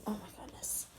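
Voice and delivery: whispered, self-conscious aside; embarrassed whisper. Real, not acted. Vlogger whispering to viewers about her chubbiness, embarrassed whisper. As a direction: hush it like a confession to the camera.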